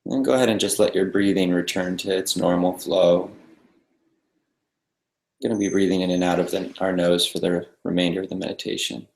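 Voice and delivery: soft, calm meditation guide with long pauses; guided meditation. Real, not acted. Meditation teacher settling a group into the breath, calm guiding voice. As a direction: ease them into stillness, leaving silence between phrases.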